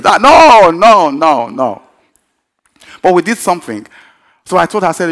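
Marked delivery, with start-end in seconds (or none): 0.0-1.8 loud high mock protest, tumbling down in pitch; emphatic refusal. Preacher acting out his disbelief about the boy's name, emphatic refusal. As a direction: burst out, then let it sink. The acted refusal flares up again.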